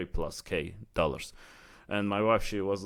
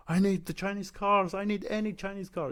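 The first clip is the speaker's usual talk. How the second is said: craving; 12 semitones higher than usual. Retold or imitated. Retelling growing obsession with cars.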